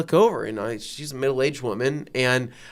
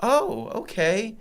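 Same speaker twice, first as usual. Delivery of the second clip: lady voiced; 8 semitones higher, much slower than usual. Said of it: "And she goes".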